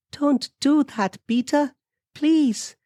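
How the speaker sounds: very high-pitched female pleading; high voice on the edge of crying; Amelia, near tears. A narrator's reading. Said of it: "Amelia began to cry".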